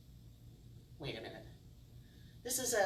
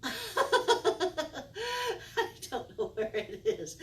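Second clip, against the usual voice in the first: laughing; 13 semitones higher, 4 dB louder than usual. Real, not acted. Trick fails again.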